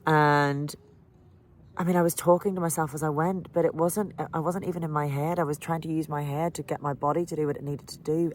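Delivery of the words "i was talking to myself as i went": ordinary delivery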